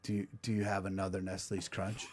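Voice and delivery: low, soft, sheepish request; meek sheepish ask. Retold or imitated. Exhausted guy asking trainer for candy, soft sheepish ask. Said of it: "I looked right at him and I go".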